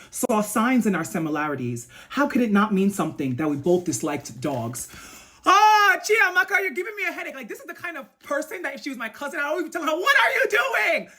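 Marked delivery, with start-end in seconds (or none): 5.4-11.1 high-pitched, wailing exasperation; exasperated scolding. Pretend. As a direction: scold a cousin in mock despair.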